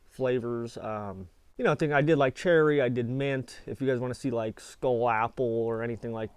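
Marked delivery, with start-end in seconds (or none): none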